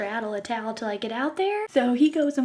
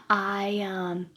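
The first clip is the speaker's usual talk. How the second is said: ordinary talk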